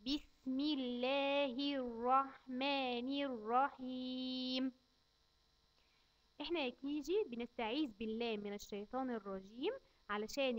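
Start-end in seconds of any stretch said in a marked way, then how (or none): 0.1-4.7 put-on slow, careful recitation for a child to repeat. Shown as a demonstration. Teacher reciting slowly for a child to repeat. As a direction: spell out each word slowly for the child. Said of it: "نقول تاني يا كيجي".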